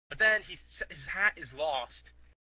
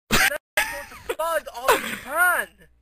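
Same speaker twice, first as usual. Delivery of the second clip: much higher, louder shouting; excited shout; 11 semitones higher, 10 dB louder than usual. Acted. Storyteller shouts the disaster to friends. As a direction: blurt it out at full volume. Building comic exaggeration.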